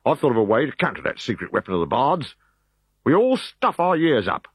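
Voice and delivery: a scheming soldier pleased with his plan; Roman officer voice. Acted.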